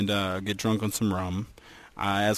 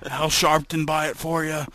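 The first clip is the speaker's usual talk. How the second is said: mock cop; 6 semitones higher than usual. Mock cop questioning a kid.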